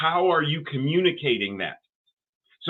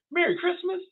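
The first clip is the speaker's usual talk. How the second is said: teasing sarcastic greeting. Shown as a demonstration. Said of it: "Is it conveyed in the inflection?".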